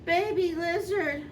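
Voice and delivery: usual speaking voice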